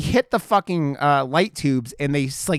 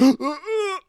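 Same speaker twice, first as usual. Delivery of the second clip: high, exaggerated gasping; mock startled gasps; imitated panicked gasp; 14 semitones higher than usual. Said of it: "anything happens, he's like".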